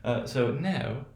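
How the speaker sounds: usual speaking voice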